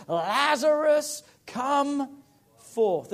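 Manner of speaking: drawn-out high shouted Lazarus; a preacher booming the dead man's name; loud call. Acted. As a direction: call him out of the tomb, long and loud. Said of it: "cried out with a loud voice".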